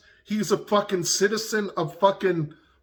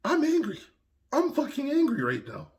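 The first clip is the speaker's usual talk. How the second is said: tense, higher-pitched admission of anger; real anger; 7 semitones higher, 4 dB quieter than usual. Real, not acted. Vlogger telling viewers, tense and higher, he is angry. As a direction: let the anger sit tight in the throat, pitched up. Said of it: "That's how angry I am right now".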